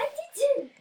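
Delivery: high loud denial; high protesting shout. Real, not acted. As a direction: yell it back in protest. Denying loudly.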